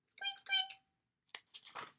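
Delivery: very high squeaky mouse noise; mouse squeak. A narrator's reading. Narrator voices Peanut the mouse squeaking. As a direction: squeak like a tiny frightened mouse.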